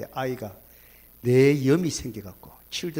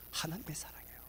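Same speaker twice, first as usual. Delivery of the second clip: 하나님의 사랑이에요 breathed as a whisper; whispered answer; 18 dB quieter, faster than usual. Real, not acted. Pastor whispering the answer again to the congregation. Repeated key line, whispered.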